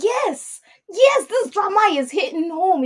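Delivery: high, thrilled yes yes; excited yes. Retold or imitated. Viewer cheering at the drama, high and thrilled. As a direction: cheer it like the show just got you. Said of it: "and I'm like".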